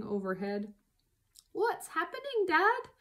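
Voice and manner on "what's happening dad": high, alarmed little fox voice; crying out. A narrator's reading. Small foxes to their dad, crying out in a high voice. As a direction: cry it out high, like a worried child. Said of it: "cried the small foxes".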